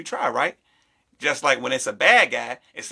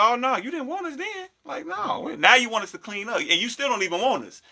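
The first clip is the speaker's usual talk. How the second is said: mock dismissive; 7 semitones higher than usual. Mocking girl who wants them back.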